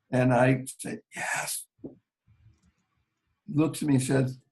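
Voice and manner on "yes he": a faint unvoiced yes; whispered answer to the male nurse. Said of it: "could barely speak".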